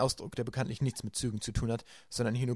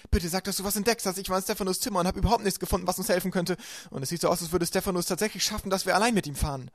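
put-on higher, louder, hurried girl; anxious Violet; 8 semitones higher, 4 dB louder than usual. A narrator's reading. Violet begs Klaus for good news, fast and anxious. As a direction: pour it out in a worried rush.

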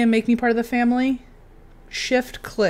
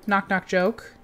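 ordinary talk, nothing marked

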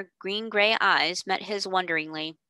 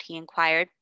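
ordinary delivery

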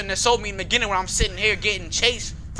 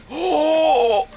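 startled gasp; 10 semitones higher, 9 dB louder than usual. Real, not acted. Near miss in game.

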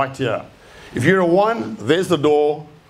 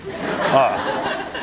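normal talking voice

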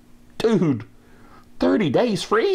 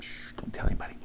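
unvoiced secret whisper; 11 dB quieter, much faster than usual.

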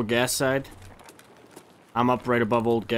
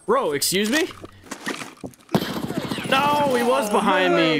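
shocked outburst; 10 semitones higher than usual. Real, not acted. Surprised by enemy behind.

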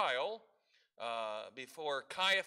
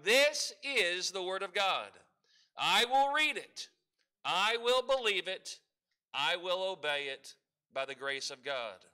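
congregational declaration; 4 dB louder than usual. Shown as a demonstration. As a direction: lead the church in saying it together. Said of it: "let's say it one more time".

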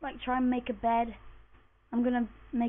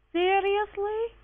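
disbelief; 9 semitones higher, 3 dB louder, much slower than usual. Real, not acted. Cave ends, repeated.

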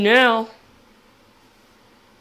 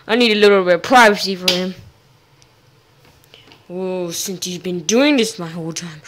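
ordinary talk, nothing marked

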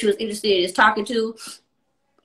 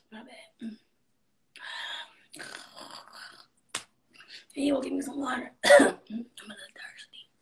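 soft hushed request off to the side; whispered aside; 5 dB quieter than usual. Real, not acted. Asking someone nearby for water, whispered. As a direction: murmur it away from the mic.

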